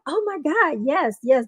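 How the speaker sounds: mock-excited yeses; retold excitement. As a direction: say it like thrilled volunteers. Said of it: "They got all excited".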